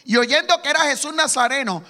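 ordinary talk, nothing marked

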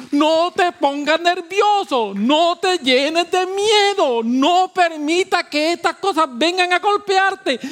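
high, loud, slow preacher's call; fiery preaching. Acted. Preacher urging the congregation not to fear, loud slow preaching. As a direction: preach it out, stretching each phrase.

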